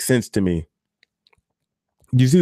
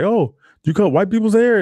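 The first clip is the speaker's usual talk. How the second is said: shocked, pitched way up; surprised high quote; 10 semitones higher, 3 dB louder than usual. Retold or imitated. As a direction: voice a startled parent asking. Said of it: "they're like".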